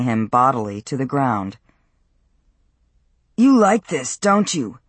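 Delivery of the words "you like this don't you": louder, higher jeering taunt; spitting taunt. A narrator's reading. Matt pins Peter down on the ground, spitting taunt. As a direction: spit it out at someone pinned beneath you. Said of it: "Matt spat".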